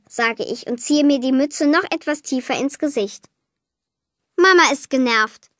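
plain reading voice

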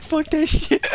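high squeaky voice; squeaky self-quote. Retold or imitated. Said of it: "I'm like".

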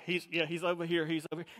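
low mock earpiece voice; radio talk imitation. Security people reporting to each other by earpiece, low imitated voice. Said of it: "pushing their earpiece in going, you know, they're talking".

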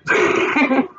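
real amused chuckle; laugh. Real, not acted. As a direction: laugh at a text message. Laughs after reading a message.

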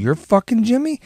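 high-pitched incredulous question; retold disbelief. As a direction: react as if you can't believe it's him. Retold disbelief at finding the boy.

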